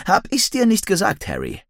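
high-pitched, eager I-told-you-so; excited young man. A narrator's reading. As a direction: start high, drop on the name. Excited friend greets narrator.

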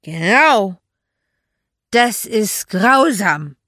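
put-on loud, slow, drunken slur; slurred agreement. A narrator's reading. Tipsy friend backing Magda up, slurred. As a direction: slur emphatic agreement, drawing the words out. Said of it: "stimmte ich ihr etwas undeutlich zu".

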